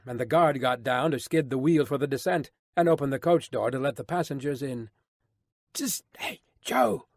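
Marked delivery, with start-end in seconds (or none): 5.7-7.1 hushed warning call. A narrator's reading. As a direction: catch his attention with a quick, voiceless warning. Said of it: "called the coachman in a warning voice".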